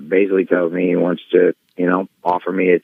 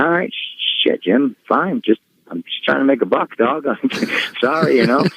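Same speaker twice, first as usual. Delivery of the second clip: exasperated; 6 semitones higher than usual. Retold or imitated. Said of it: "So I'm like".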